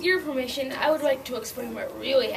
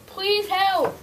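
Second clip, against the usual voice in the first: pleading wolf; 7 semitones higher, 4 dB louder than usual. Acted. Wolf begging.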